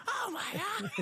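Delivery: very high, mock horrified cry; imitated screaming. Actor mimics the crew's reaction to a hit, high mock scream. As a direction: squeal it through laughter. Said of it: "We're screaming".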